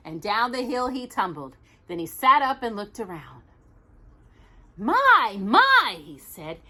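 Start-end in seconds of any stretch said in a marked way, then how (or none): 4.8-6.0 higher, louder little bear voice. Acted. Reading to Jocelyn, Little Bear amazed on the moon.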